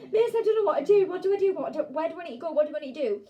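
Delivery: high, loud, frantic pleading; mock panic attack. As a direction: blurt it out like a panicking little pupil. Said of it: "having, like, a little panic attack".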